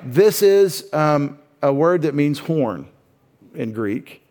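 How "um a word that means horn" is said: ordinary talk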